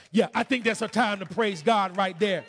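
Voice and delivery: high, shouted gospel preaching; full-force preaching. Acted. Preacher telling church God never left, full-force shouted preaching. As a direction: shout it to the rafters in praise.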